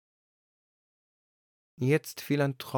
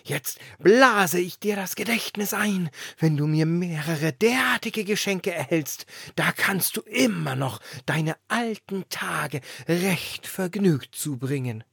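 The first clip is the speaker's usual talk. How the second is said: loud blustering wind; 7 semitones higher, 6 dB louder than usual. A narrator's reading. The wind boasting to the lantern, booming. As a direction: wind voice, higher and louder. Wind gives memory gift.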